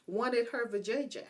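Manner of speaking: ordinary talk, nothing marked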